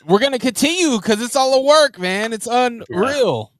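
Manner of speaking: hyping up the podcast crowd with mock wrestling-promo energy; hyped wrestling promo.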